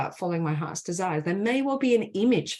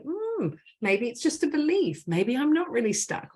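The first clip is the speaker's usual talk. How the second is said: higher, musing doubt about being stuck; wondering inner part; 7 semitones higher than usual. Retold or imitated. Coach voicing the stuck part beginning to doubt, higher and musing. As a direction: voice a hesitant part of you starting to wonder. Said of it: "that part of you starts to go".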